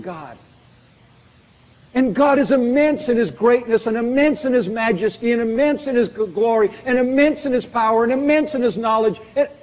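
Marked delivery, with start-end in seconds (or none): 1.9-9.5 booming preacher, lifting every phrase; preaching at full force. Acted. Preacher to his congregation, booming and lifted. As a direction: proclaim it to the whole congregation. Building list of God's attributes.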